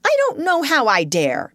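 high, daring reply; reckless courage. Acted. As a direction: answer back with sudden nerve. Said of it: "with a kind of reckless courage".

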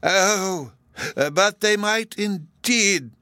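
high, slow, loud dithering protest; flustered, drawn-out objection; protesting professor. A narrator's reading. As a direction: protest haltingly in a high academic voice. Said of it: "the other professor protested".

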